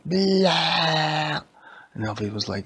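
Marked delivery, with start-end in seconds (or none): 0.1-1.4 higher, popping blah to spook; spring it out to scare; jump scare blah. Acted. The Siege scares Elvie, sudden blah. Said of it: "And the Siege was like".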